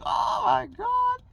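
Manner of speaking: very high, slow, loud squeal; mock astonishment. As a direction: stretch it into a hammed-up falsetto cry. His stunned reaction, hammed up.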